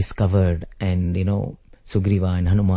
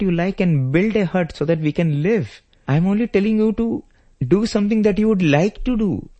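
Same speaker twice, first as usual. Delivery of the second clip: bewildered protest; 12 semitones higher than usual. Acted. Rama asking crying brother what he did wrong, bewildered. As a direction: stretch the words in puzzled, high-pitched appeal.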